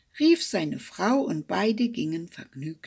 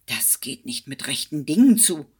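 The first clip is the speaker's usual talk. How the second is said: calling out; 3 semitones lower, 6 dB louder than usual. Acted. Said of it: "rief er".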